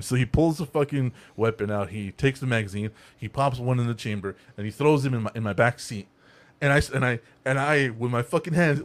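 normal talking voice

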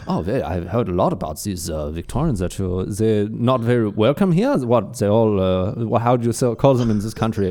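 put-on German accent, halting for words. Newcomer asking locals about Victorians, mock German accent. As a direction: play a puzzled German newcomer speaking English. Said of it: "Reply in German".

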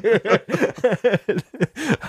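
high burst of laughter; laughing. Real, not acted. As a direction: crack up at yourself. Laughs at own announcer bit.

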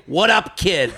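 put-on Boston accent, casual greeting. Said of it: "Boston accent".

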